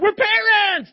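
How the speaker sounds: high, loud gushing cry of pride; thrilled parents. Retold or imitated. Pastor imitating eager preschool parents, high and loud. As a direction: shout it with delight like proud new parents. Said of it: "Others of you are like".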